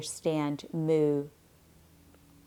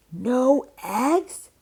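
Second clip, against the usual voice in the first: much higher, loud shocked cry; 9 semitones higher, 6 dB louder than usual. A narrator's reading. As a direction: shout it out in disbelief, voice jumping up. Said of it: "cried Farmer Brown".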